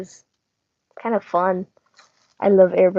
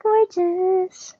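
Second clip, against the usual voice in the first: pitched way up, admiring; high admiring gush; 11 semitones higher than usual. Real, not acted. Admiring the painted figure.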